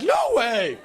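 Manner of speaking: high, loud squawked refusal; overblown, high-pitched blurt; panicked refusal. Retold or imitated. Boy too scared to dance with the girl, panicked blurt. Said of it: "it was probably like".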